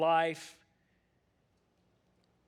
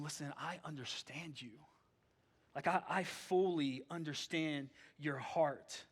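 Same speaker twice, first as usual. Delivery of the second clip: gentle voice of God; 8 dB quieter than usual. Acted. God answering the worried believer.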